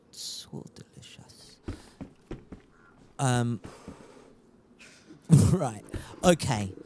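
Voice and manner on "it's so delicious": whisper. Acted. Fading repeat of accent line.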